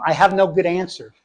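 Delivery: ordinary talk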